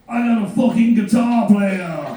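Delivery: hammed-up rock star cheer. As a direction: crow it to the room. [name] announces to the crowd.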